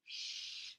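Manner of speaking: long unvoiced "Shh" modelled for a child; sh sound. Shown as a demonstration. Speech therapist modelling "Shh" for a child, soft and unvoiced. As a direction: show the "Shh" sound, lips rounded, no voice. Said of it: "Do you hear the S H sound".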